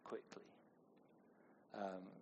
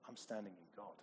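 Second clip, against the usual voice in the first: soft, steady quiet trust; calm and settled; a believer declaring trust, soft; quiet confidence; 3 semitones lower, 13 dB quieter than usual. Retold or imitated. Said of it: "with that posture of".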